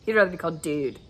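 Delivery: normal talking voice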